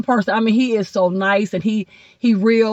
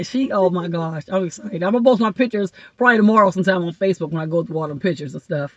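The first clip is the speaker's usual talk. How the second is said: ordinary talk, nothing marked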